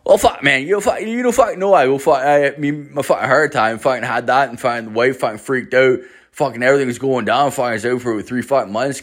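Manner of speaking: put-on thick Newfoundland accent. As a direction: rattle it off in a thick Newfoundland accent, swearing every word.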